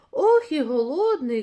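loud, high storybook moan of hunger; hungry exclamation. A narrator's reading. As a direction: exclaim eagerly at the food. Mouse exclaims over its hunger.

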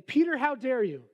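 indignant rebuke. Pretend. Pastor jokingly voices God scolding Peter, mock indignant. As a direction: play it like a scolding voice from heaven. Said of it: "No, that's not what he said".

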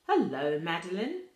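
loud hearty hello; booming greeting. Acted. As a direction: boom the greeting across the room. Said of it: "he boomed".

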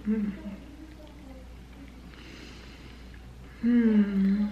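chewing on a chicken wing; murmuring between bites; mouth full. Real, not acted. Seen 0: Bites and chews wing meat throughout the labelled murmurs.

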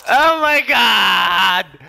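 loud, drawn-out panicked shout; panicking gamer. Real, not acted. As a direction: stretch out a real panicked shout. Game chaos, shouting at screen.

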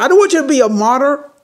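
upset wife. Retold or imitated. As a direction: snap back at him in exasperation. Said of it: "she gets all upset".

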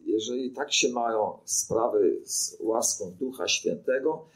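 normal talking voice

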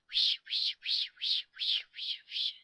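loud, voiceless rustling "wish" repeated; loud tree whisper. A narrator's reading. Trees whisper loudly to the children, a rushing voiceless rustle. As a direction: whisper forcefully like leaves in wind. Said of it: "said the trees loudly".

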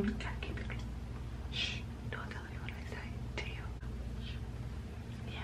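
secretive hushed whisper. Real, not acted. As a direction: breathe it like a secret. Telling viewers a secret.

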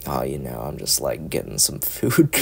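put-on low, soft goth girl voice; lightly voiced tired goth girl, low and soft; goth femme voice. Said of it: "in the most goth fucking femme voice".

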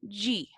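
G sounded "dji", like English "jee"; final DE as "dji". Shown as a demonstration. Teacher showing the class word-final DE, pronounced "dji". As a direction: say G as a soft Portuguese "dji". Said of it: "lo vamos a pronunciar como".

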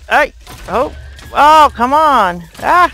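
loud, high, drawn-out outcry; frustrated yell. Real, not acted. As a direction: cry out as everything goes wrong. Creeper attack.

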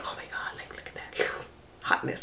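awed hush over his looks; swooning whisper; breathless whisper. Real, not acted. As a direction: whisper it like a secret crush.

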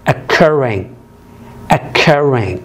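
occurring with CUR stressed; occurring said as oc-CUR-ring; stress on second syllable. Shown as a demonstration. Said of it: "الستريس على المقطع الثاني".

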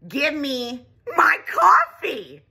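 slow, loud, strained demand; snapping. Retold or imitated. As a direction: bite off each word of give me my coffee. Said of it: "I snapped and I said".

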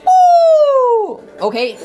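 high loud excited cry; excited yelp. Real, not acted. Gamer thrilled as freeze lands, high loud yelp. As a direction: burst out when the freeze hits.

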